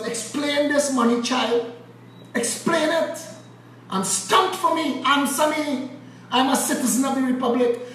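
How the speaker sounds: high, insistent demanding voice; mock demanding interrogation. Demanding Erica Williams explain the money, pitched high. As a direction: grill her like a stern official. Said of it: "I want to ask Erica Williams".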